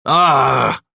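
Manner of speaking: loud strained growl; growl through clenched teeth. Acted. Bass struggling under Paddock's grip, loud growl. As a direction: grunt it out through gritted teeth. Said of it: "Bass growled through clenched teeth".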